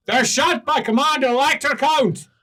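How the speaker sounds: high, loud guard laying down the rules; guard on the wall telling the party the gates are shut, loud. Acted. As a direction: announce it from the wall like a smug guard. GM voices the guard.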